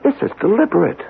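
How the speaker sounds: high, agitated pleading about coming disaster; frantic scientist begging the colonel to stop the tests; urgent warning. Acted. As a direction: plead with rising panic, voice pitched high.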